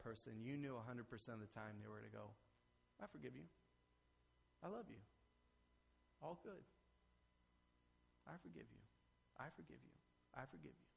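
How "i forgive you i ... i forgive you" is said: soft, tender reassurance; gentle forgiveness. Acted. God softly assures the repentant sinner, tender and quiet. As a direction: hush it like comforting a child.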